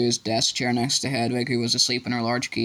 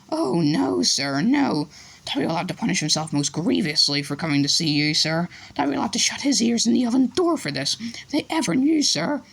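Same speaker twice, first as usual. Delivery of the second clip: much higher, fearful elf; frightened elf; 7 semitones higher than usual. A narrator's reading. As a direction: elfo spaventato, voce acuta. Elf afraid of punishment.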